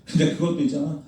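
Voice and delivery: ordinary talk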